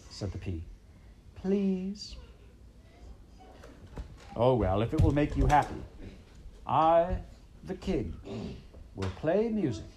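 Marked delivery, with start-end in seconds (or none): 1.3-2.2 high little pleading please; pleading princess. A narrator's reading. 4.4-9.9 pompous king. A narrator's reading. King gives in grandly.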